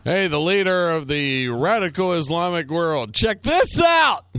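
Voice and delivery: hammed-up shout rising to a yell; mock announcement. Announcing the taunting broadcast.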